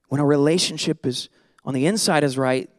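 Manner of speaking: normal talking voice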